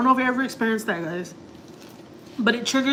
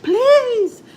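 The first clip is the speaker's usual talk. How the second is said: high, louder imitated begging cry; retold pleading; 8 dB louder than usual. Neighbor begging husband not to leave, high imitated plea. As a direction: beg high and loud, imitating her. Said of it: "She's like".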